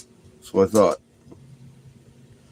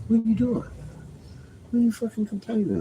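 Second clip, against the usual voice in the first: mock scolding dog; 11 semitones higher, 5 dB quieter, faster than usual. Real, not acted.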